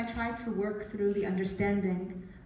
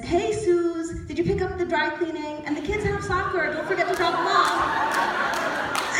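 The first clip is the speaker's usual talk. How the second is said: higher and louder, casual husband rattling off chores, slowing at the end; breezy husband reminding his wife of errands; 8 semitones higher, 6 dB louder, slower than usual. Retold or imitated. Publisher mistakes her for his wife.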